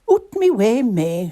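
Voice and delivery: high, loud Mrs Claus shooing her reindeer. A narrator's reading. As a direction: shoo a pet from underfoot, loudly. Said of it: "she said to her pet reindeer".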